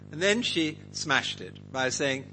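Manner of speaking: ordinary talk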